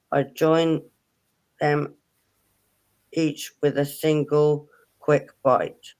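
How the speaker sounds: slow, deliberate riddle reading. Real, not acted. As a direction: read it out slowly, phrase by phrase with pauses. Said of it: "I'll say it slowly as I can".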